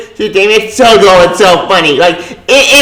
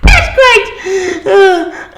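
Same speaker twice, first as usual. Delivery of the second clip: high, breathless laughing words; real helpless laughter; laughing hard; 12 semitones higher, much slower than usual. Real, not acted. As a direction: squeeze the words out through laughter. Laughing hard after the joke.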